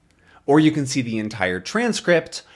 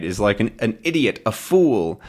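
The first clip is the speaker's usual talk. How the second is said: ordinary delivery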